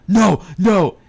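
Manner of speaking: high, loud panicked cry of no; horrified shout at the movie. Retold or imitated. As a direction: yell it at the screen in dread. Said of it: "I was like".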